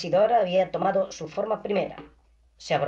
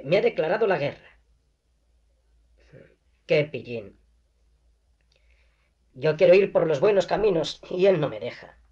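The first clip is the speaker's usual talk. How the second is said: frantic agitation. A narrator's reading. Isidora to Augusto, about God, frantically agitated. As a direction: rush it like she is near madness. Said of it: "con tanta agitación que parecía demente".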